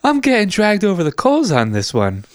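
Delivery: high-pitched frantic salesman; squeaky panicked dealer. Pretend. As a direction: squeal like a stressed car dealer. Mock car-dealer bit, high voices.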